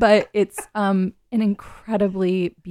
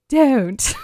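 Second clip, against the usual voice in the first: louder, higher mock British accent; 5 semitones higher, 5 dB louder than usual. Host mimics disapproving British listeners, hammed-up British accent. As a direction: exaggerate one word in a bad British accent. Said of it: "they say".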